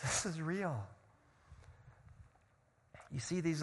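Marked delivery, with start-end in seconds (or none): 0.0-0.9 "real" repeated after a laugh, lighter. Real, not acted. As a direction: let a laugh lead into "real".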